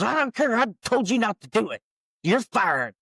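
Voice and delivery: acted angry boss, high then dropping on the verdict; dismissive scolding. Boss refuses the singer's excuse, angry. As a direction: overrule her crossly. Boss overrules her, retold.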